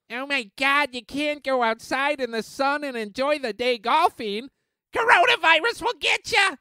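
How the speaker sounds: high, shrill-edged mockery ending in a yelp; mock the fearful crowd, rising to a shout; mock panicky voice. Ranting vlogger mocks virus panic in a high put-on voice.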